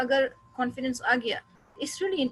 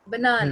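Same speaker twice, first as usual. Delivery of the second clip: Banana with a quick first ब and a long, loud middle ना; stress on the middle syllable; 5 dB louder than usual. Shown as a demonstration. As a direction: punch the middle ना of banana, rush the ब. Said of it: "किसी पे बहुत जल्दी बोल देते हैं".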